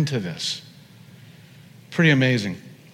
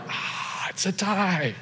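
hushed, breath-led mock amazement; playful stage-whispered result; game show verdict; 4 semitones higher, 8 dB quieter, slower than usual. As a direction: breathe out the "Ah", then announce softly and high. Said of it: "game show in heaven".

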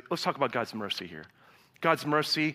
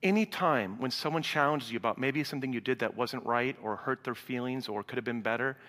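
ordinary talk

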